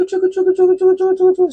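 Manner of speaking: high rhythmic chugga noise; chugging sound. Shown as a demonstration. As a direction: imitate a little engine chugging along. Mimics chugging slowly past a car.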